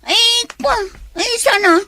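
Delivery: caught-out stammering; flustered stammer. Acted. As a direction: scramble for an excuse.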